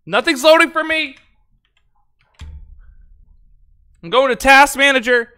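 worked-up, frazzled voice; very flustered. Real, not acted. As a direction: complain loudly as the tech fails. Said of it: "I get very flustered".

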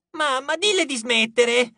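high, drawn-out tattling; childish protesting cry; whining complaint. A narrator's reading. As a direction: wail to mother about your sister. Demon child complaining to mother.

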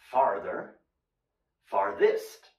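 "Farther" and "Farthest" low and slow with a lifted end; teacher enunciating "Farther" then "Farthest"; careful model pronunciation. Shown as a demonstration. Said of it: "So, A R".

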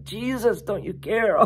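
high, mock-tearful plea; near tears. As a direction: act stressed out, pleading on the verge of tears. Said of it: "perhaps even to the point of tears".

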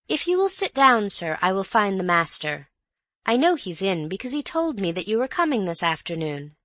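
high, polite maid voice. Acted. Maid asking the visitor to sit, polite and high. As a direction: settle the guest politely.

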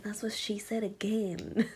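slow, sheepish whisper. Real, not acted. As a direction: whisper the joke slowly. Whispering the joke to herself.

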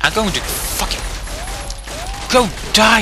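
usual speaking voice

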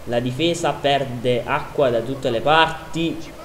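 normal talking voice

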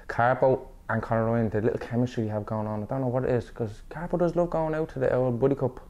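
ordinary talk, nothing marked

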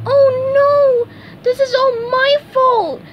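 very high, loud cartoon wail; squeaky-high, panicked shout; SpongeBob crying out. A narrator's reading. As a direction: cry out in high-pitched dismay. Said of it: "SpongeBob cried".

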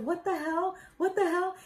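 high-pitched retold shock; confused disbelief. Woman retelling her reaction to the proposal, confused disbelief. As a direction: replay stunned disbelief, voice lifting. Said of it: "Like, so confused".

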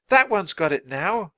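high, loud alert; yelling. Acted. Girl pointing at the second thief, yelling high. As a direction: yell it to your companion. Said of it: "Annie yelled".

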